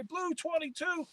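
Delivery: high, restrained snap-count call; quarterback calling signals. Retold or imitated. Calling cadence at the line.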